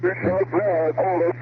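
ordinary talk

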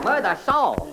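normal talking voice

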